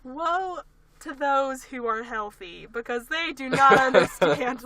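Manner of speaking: slow, hammed-up woe-is-me lament; mock tragic wail, drawn out slowly; dramatic sick lament. Friend mocking herself while sick, hammed-up slow lament. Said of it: "I just get really dramatic".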